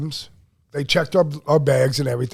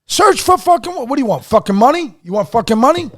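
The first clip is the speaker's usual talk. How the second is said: much higher, louder indignant yelling; angry outburst; 6 semitones higher, 5 dB louder than usual. Retold or imitated. Traveler yelling at Mexican customs agents, outraged shout. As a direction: fire back at the officers in outrage.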